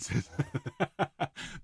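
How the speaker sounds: amused chuckling burst; real laugh. Real, not acted. Choir leader amused by a question, laughing. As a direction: laugh at the question just read.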